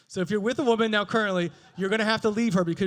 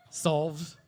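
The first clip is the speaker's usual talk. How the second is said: ordinary talk, nothing marked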